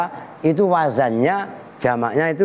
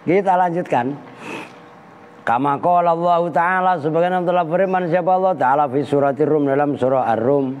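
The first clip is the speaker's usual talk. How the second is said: normal talking voice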